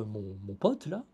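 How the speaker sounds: ordinary delivery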